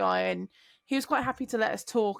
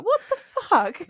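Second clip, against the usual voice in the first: much higher, louder disbelief; imitating shocked room; 11 semitones higher, 6 dB louder, slower than usual. Coworkers hearing the teeth excuse, loud disbelief. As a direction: blurt it out stunned. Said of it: "everyone was like".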